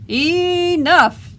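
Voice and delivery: a high, loud fed-up cry of enough; villagers shouting. Acted. Fed-up villagers yelling at the terrible dragon, loud shout.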